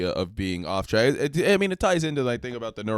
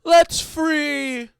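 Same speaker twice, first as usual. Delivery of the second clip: crowd shout; 8 dB louder than usual. Acted.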